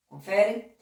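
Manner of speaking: ordinary delivery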